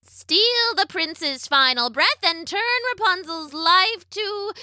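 high, slow incantation climbing toward a climax; witch's spell rising in pitch; witch casting a spell. A narrator's reading. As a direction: chant it high, stretching the words, building. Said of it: "The witch's spell turned into".

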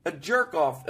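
ordinary talk, nothing marked

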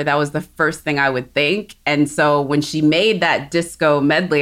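ordinary talk

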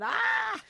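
high startled scream; horror movie screaming. Retold or imitated. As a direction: shriek at the faces in the window. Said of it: "And I went".

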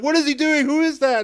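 high, baffled crowd voice; confused crowd. Retold or imitated. Fan voicing the crowd at the presentation, high and baffled. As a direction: gasp it like a shocked onlooker.

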